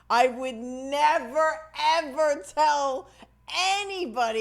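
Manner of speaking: slow, loud, drawn-out never and ever; slow loud insistence; emphatic vow. Real, not acted. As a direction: lean hard on never and ever.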